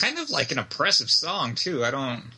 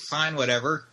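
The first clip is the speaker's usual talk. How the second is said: ordinary talk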